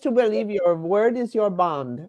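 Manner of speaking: a hint of Melania, measured and grave; a lightly voiced Melania-style line; Melania impression. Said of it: "Can you combine Melania with Susan Collins".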